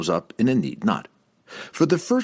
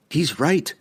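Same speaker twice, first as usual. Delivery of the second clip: higher-pitched, sharp agreement; curt snap with raised pitch; snapped; 6 semitones higher than usual. A narrator's reading. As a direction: snap it at your father. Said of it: "Catterbury snapped in her father's ear".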